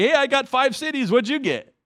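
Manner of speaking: high, loud boastful taunt; mock bragging. Mocking a believer who brags about his reward. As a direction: show off your reward like a smug winner. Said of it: "you don't say".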